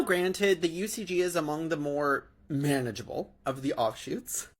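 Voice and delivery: ordinary delivery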